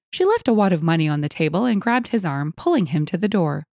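ordinary delivery

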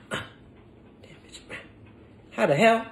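normal talking voice

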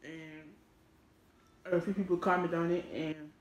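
pausing to chew between words; chewing while talking. Real, not acted. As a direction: mouth partly full. Seen 1: Eats snack before; closed, bulging chewing mouth at 1.9-3.1 s.

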